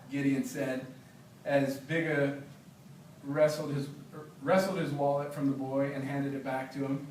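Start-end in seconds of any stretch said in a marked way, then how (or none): none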